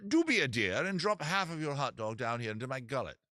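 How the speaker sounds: polite, hammed-up British seagull; British accent. Seagull politely begging half a hot dog, British accent. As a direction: make a courteous request in a mock British accent. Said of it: "give you a British accent".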